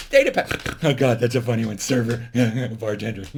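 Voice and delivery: laughing through the words; tickled by your own joke, chuckling as you talk; cracking up. Real, not acted.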